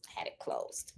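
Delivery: breathy whisper to herself; hushed aside while fiddling with the hoop. Real, not acted. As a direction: mutter it under your breath. Aside while fumbling with camera.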